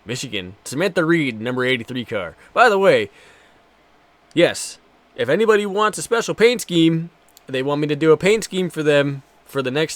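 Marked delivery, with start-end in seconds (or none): none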